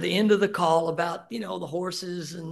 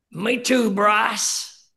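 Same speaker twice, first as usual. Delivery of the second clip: a louder, higher voice for his father; voicing dad's reply, a bit raised; 5 semitones higher, 9 dB louder, much slower than usual. Dad answering his son on the phone, louder and higher.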